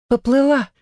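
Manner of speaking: sharp high offended question; indignant echo. Acted. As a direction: throw the word back, offended. Offended echo of his word.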